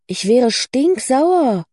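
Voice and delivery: high, drawn-out indignation on "stinksauer"; outraged. Acted. Cora on hearing Lucy's news, outraged on her behalf. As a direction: stretch "stinksauer" out, swooping high.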